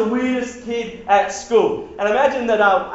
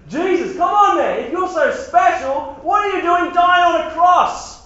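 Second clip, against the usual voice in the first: high, louder jeering voice; mocking taunt; 10 semitones higher, 5 dB louder than usual. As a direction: jeer at him like a bully. Rulers mocking Jesus.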